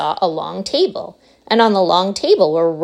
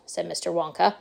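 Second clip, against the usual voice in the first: ordinary delivery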